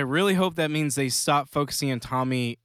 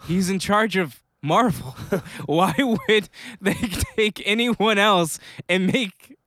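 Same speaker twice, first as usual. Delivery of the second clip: rising, incredulous sarcasm; sarcastic; 5 semitones higher than usual. Real, not acted. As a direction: scoff at the obvious news. Mocking obvious news.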